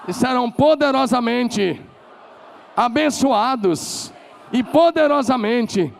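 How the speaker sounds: high, loud, drawn-out shout; shouted declaration. Shown as a demonstration. Pastor leading the congregation in a shouted declaration. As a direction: shout it out, stretch the last words.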